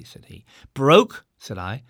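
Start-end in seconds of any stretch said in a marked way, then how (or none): 0.7-1.2 high, loud astonished echo; loud startled query; surprised. A narrator's reading. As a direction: snap the word back in disbelief.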